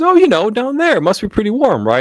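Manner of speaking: high, airy voice of a clueless woman; clueless cashier. Retold or imitated. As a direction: play her sweetly oblivious. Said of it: "She goes".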